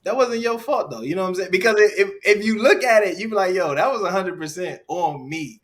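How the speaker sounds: loud, high, emphatic insistence; insistent reassurance. Real, not acted. Speaker telling the apologizing woman it wasn't her fault, loud insistent reassurance. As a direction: raise the voice to take all the blame.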